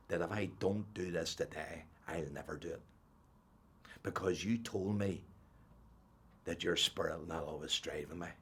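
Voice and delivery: a man's humble, quiet plea to God; hushed prayer. Retold or imitated. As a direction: pray it quietly on your knees. Retells his prayer to Jesus.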